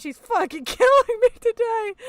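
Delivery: laughing through the words; can barely get it out for laughing; helpless laughter; laughing hard. Real, not acted.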